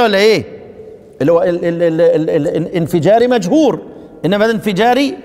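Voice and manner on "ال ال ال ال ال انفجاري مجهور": ordinary delivery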